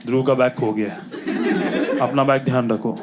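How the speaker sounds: low-pitched mock school announcement; principal's announcement. Principal to the school about a lost bag, announcing. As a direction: imitate a principal making an announcement, pitch dropped. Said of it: "He will announce".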